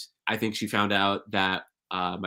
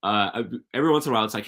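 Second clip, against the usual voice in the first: ordinary delivery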